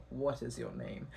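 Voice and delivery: low, very soft imitated menace; hushed, deadpan impression of a creepy man; emotionless stranger. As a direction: ask it quietly, with no emotion. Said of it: "no emotion in his eyes, and he goes".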